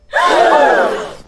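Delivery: sharp high gasp; shocked gasp. Retold or imitated. Cousin falls off sofa.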